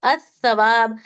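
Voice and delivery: अस्सवाब with the lam silent and a doubled स; correct assimilated reading. Shown as a demonstration. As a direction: say अस्सवाब as "as-sawab", no l sound. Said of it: "इसे कैसा पढ़ेंगे अब हम?". (अस्सवाब को लाम के बिना, स पर तशदीद के साथ)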